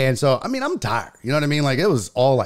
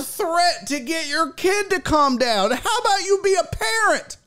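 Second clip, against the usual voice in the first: high, raised indignant rant; outraged rant. Real, not acted. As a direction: vent at the parent, crying out. Ranting at the absent parent.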